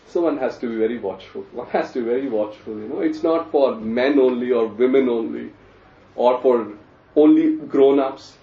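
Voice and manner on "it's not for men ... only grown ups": ordinary talk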